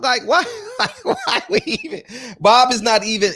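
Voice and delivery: high squeaky laugh through the words; laughing disbelief. Real, not acted. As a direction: crack up mid-question. Laughing at Bob Arum talk.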